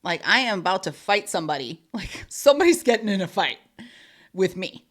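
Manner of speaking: loud, exasperated rant with a laugh; half-laughing mock blowup about fighting someone; retold fed-up outburst. Venting to a co-host about snapping at people, loud. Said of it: "And I was like".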